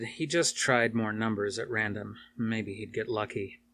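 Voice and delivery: ordinary talk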